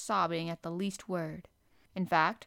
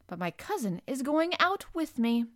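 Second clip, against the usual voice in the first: put-on higher, mildly imperious boy; lordly young Rajah; 6 semitones higher, 4 dB louder than usual. A narrator's reading. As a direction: insist lightly, like a little prince. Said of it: "replied the Rajah".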